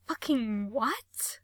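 slow, breathless disbelief; shocked whisper. Acted. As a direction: whisper it aghast. Shocked reaction to skin warning.

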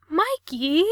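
high, loud yelp of a name. A narrator's reading. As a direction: cry out in panic as he hangs up. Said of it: "Gerard yelped".

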